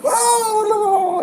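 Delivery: high-pitched panicked cry; mock panic. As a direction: wail as if imitating total hysteria. Mimics everyone losing their mind.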